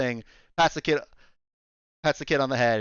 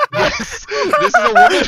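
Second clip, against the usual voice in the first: high, loud, laughing through the words; 11 dB louder than usual. Real, not acted. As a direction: squeal it out while cracking up. Laughing about own accent.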